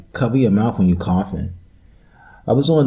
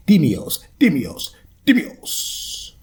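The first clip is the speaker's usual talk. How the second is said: higher, rolling mock-Spanish Dimios; 8 semitones higher, much slower than usual. Mock Spanish for a podcast audience. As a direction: ham up a Telemundo Spanish accent. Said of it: "It's gonna sound like some Telemundo shit".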